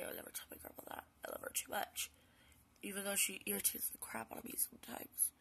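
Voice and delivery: fast whispered aside; whispered confession. Real, not acted. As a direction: whisper it as a secret. Confiding asides.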